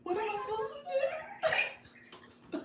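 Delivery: very high chipmunk effect, laughing. Real, not acted. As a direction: squeak the question through a chipmunk filter. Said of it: "this says Chipmunk, y'all".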